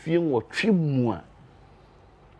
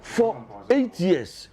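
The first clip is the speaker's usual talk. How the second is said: slow emphasis; 5 semitones higher, much slower than usual. Real, not acted. Guest stressing years of neglect.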